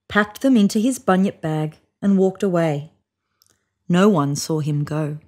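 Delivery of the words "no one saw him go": ordinary delivery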